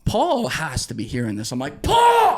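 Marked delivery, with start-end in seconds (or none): 1.8-2.4 high, loud scream of a name; screamed name, much higher and louder. Retold or imitated. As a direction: scream across the beach for help. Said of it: "I'm screaming [name] now".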